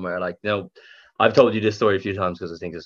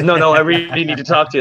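pushy bouncer; 8 semitones higher, 4 dB louder than usual. Retold or imitated. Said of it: "he goes".

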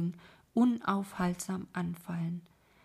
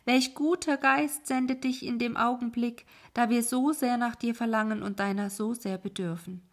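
high, loud hopeful call; 3 semitones higher, 4 dB louder than usual. Acted. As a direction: call out to him in relief. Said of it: "rief ihm zu".

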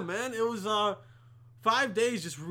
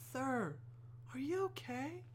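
soft, high, careful question; concerned flight attendant; 6 semitones higher, 10 dB quieter than usual. Retold or imitated. Flight attendant asking a crying passenger, soft concern. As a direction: gently check on someone in a hushed high voice.

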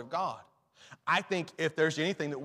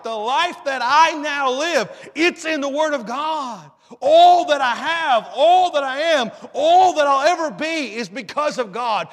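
full-force preaching; 6 semitones higher, 6 dB louder than usual. Real, not acted. Preacher testifying his life is in God's word, full-force preaching. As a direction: build to a ringing pulpit climax.

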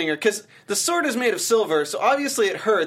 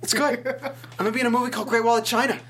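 higher-pitched, chattering movie star impression; Mark Wahlberg; 5 semitones higher than usual. Movie star plugging his new film, mock impression. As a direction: plug your film like an eager celebrity, hammed up. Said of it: "Mark Wahlberg going into the airport".

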